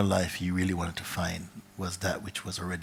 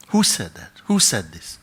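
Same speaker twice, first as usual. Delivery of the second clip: startled shout; 9 dB louder than usual. Acted. Seeker in the cave calling out, alarmed shout.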